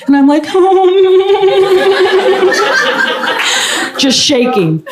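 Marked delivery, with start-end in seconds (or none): 0.5-3.9 giddy high-pitched laugh; high nervous laugh. Real, not acted. Laughing nervously as he leans in close. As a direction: laugh high and shaky with nerves.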